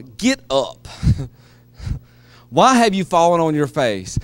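gentle God. Acted. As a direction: coax him up tenderly. Compassionate God speaking.

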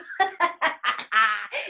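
loud, rapid ha-ha cackle; gloating bear laugh. Acted. Bear outdoing the rabbit, gloating laugh. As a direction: laugh loudly in triumph.

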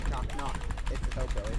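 low, put-on killer voice; teasing hillbilly killer. Repeats the hillbilly killer tease.

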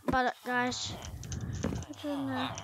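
ordinary delivery

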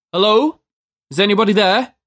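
high-pitched questioning call; shouted. A narrator's reading. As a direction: call out into an empty building. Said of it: "he shouted".

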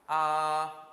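"아" held long, high and level; first tone. Shown as a demonstration. Teacher leading learners to repeat, high level tone. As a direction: sing out "아" on one steady high note. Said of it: "일 성, 시작".

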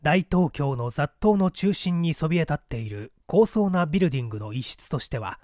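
plain reading voice

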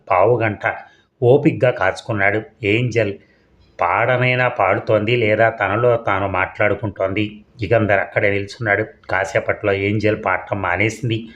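plain reading voice

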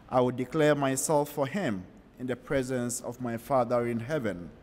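normal talking voice

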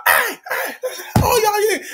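high-pitched imitated holdup calls; calling out. Robbers bursting into tattoo shop, high calling out. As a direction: fire off mock high calls for attention.

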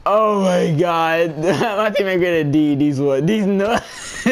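high-pitched, drawn-out start tipping into giggles; laughing hard. Real, not acted. Streamer repeating his prank mid-game, laughing hard. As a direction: crack up on the punchline. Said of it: "I'm laughing so hard".

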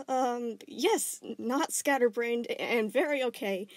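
high, slow halting reply; nervous stammer. Acted. Nervous boy stammers he is very okay. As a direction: stammer through it. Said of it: "he stammered".

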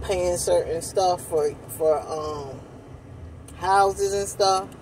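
spoon against lips; talking between bites; eating ice cream. Real, not acted. Seen 2: Ice cream spoon held at her lips throughout the labelled speech.

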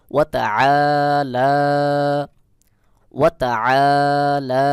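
long throaty আ, then an equally long লা; measured ওয়া তা, stretching both আ and লা; correct elongation. Shown as a demonstration. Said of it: "সহি উচ্চারণ হলো". (আ ও লা টান দিয়ে সহি উচ্চারণ)

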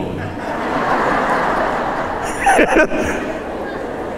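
soft unvoiced chuckle; airy chuckle; breathy laugh. Real, not acted. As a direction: laugh quietly at the joke. Laughs at his own joke.

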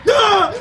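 short, loud, very high shriek; real startled scream; scared scream. Real, not acted. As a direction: yelp in terror. Monster jump scare.

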